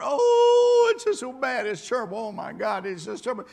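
high, wailing imitation of the doom-sayers; hammed-up lament; mock gloomy moaner. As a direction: whine it like a hand-wringing pessimist. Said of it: "everybody you see are".

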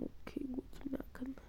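whispered aside; whispering. Real, not acted. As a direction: whisper it to yourself. Aside while playing.

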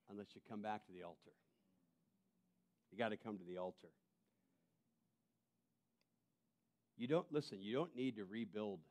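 soft, low, intimate invitation to come back; quiet appeal. Acted. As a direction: draw them in gently, almost at a murmur. Quiet tender altar appeal.

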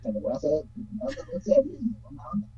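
speaking away from the mic; faint, distant voice; far from mic. Real, not acted. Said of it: "Pues no se escucha casi".

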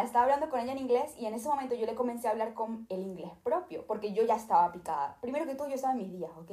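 ordinary talk, nothing marked

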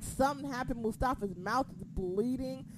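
usual speaking voice

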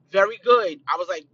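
ordinary delivery

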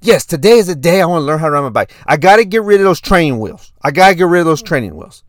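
impression of an excited young son; four-year-old son. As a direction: plead urgently like a small kid. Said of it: "do my best impression of my son".

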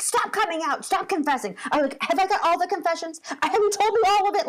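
retold fed-up outburst; exasperated parent. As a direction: imitate a parent at the end of their rope.

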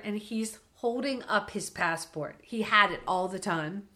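ordinary talk

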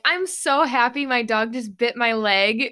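forced cheery voice; overly positive. Retold or imitated. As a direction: fake sunny positivity. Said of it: "overly positive".

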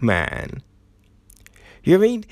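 normal talking voice

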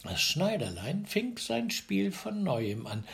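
ordinary delivery

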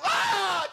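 high loud shocked scream; shocked yelp. Retold or imitated. Child touches the socket, high shocked scream. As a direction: cry out as the current hits.

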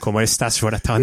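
teasing cohost in a light fake Mexican accent; mock Mexican accent. Mocking cohost's advice in a light Mexican accent. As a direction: parrot his line in a hint of Mexican accent. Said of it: "T'as un accent".

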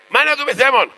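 very high, squawked question; acted exclaimed question. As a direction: jump high as the exasperated old rabbi. Old rabbi answers the quarrel.

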